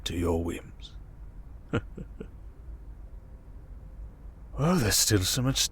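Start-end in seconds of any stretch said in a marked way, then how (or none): 1.0-4.6 breathless, hushed chuckle; a quiet amused laugh under the breath; soft villain chuckle. Acted. Tied-up villain chuckling at the tense hero, hushed laugh.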